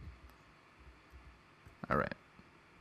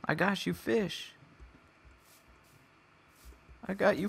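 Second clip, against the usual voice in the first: higher, sing-song coaxing voice; coaxing high call; 9 semitones higher than usual. Real, not acted. Player calling the lost cat with fish, high coaxing voice. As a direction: lure the kitty back, pitched up.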